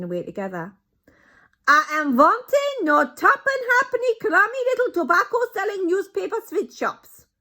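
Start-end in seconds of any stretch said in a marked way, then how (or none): 1.7-7.3 put-on much higher, loud scornful accented shout. Grand High Witch scorns small shops, loud high shout. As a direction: sneer at cheap shops. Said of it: "shouted the Grand High Witch".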